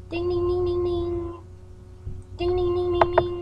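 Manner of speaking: high sung ding ding like a phone ringing; phone ring noise. Acted.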